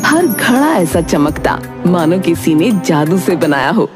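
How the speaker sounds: usual speaking voice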